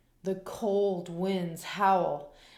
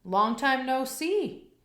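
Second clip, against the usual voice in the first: higher, cheerful friendly voice; hare's cheery greeting; 6 semitones higher, 4 dB louder than usual. A narrator's reading. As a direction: sound glad to see an old pal. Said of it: "says Hare".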